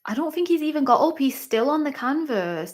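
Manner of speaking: high, fast worried rush. Real, not acted. Viewer noticing the fighter is still down, high and fast. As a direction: blurt it anxiously.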